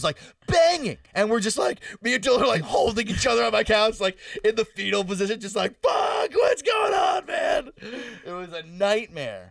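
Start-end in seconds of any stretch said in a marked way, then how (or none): 0.5-1.0 high, loud burst on one word; shouted banging. Retold or imitated. Friend imitating neighbor pounding every door, shouted burst. 5.8-7.7 very high, shouted panic; mock panic. Two friends clutching each other on the couch, mock panicked yell.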